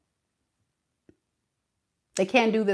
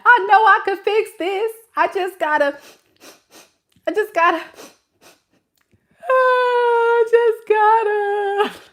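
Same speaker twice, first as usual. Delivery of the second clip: higher mock whine building to a sing-song sob; whiny child; 12 semitones higher, 3 dB louder than usual. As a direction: start pleading, end in a drawn-out fake cry. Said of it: "whining and complaining like a child".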